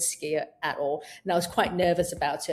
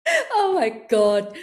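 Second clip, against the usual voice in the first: slow, amused oh my God; laughing it off; 3 semitones higher, 5 dB louder, much slower than usual. Retold or imitated. Coach shows laughing off a forgotten thought, amused. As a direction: laugh at yourself lightly. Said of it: "you can laugh and go".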